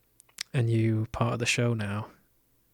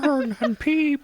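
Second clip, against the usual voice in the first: high, slow, drawn-out pigeon coo; 14 semitones higher, 3 dB louder, much slower than usual. Shown as a demonstration. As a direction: coo the words slowly up high. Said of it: "How do you coo this?".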